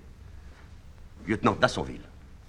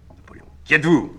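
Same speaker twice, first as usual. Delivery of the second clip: normal talking voice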